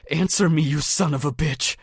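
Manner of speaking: high, loud screamed demand; gun-pointing furious yell; furious shout. A narrator's reading. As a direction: scream at him to answer.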